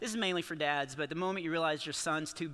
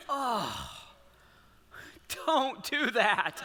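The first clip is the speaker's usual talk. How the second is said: comic warning; 3 semitones higher, much slower than usual. Real, not acted. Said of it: "comic warning".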